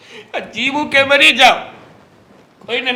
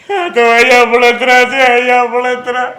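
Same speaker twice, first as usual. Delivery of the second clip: louder, overdone complaint of burning inside; hammed-up anguish; 7 dB louder than usual. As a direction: ham up the heartache. [name] dramatizes his heartache.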